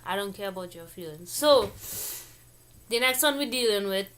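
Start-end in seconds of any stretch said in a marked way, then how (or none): 0.1-1.3 talking while chewing; mouth full. Real, not acted. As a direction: speak through a mouthful of food. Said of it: "talk with my mouth full".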